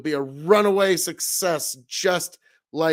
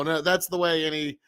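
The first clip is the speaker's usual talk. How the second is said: ordinary delivery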